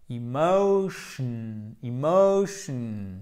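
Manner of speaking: very slow "emotion", long stressed MO; accent tonique. Shown as a demonstration. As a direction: stretch the diphthong O in "emotion". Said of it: "l'accent tonique est sur la syllabe juste avant". (« emotion » très lent, MO allongé)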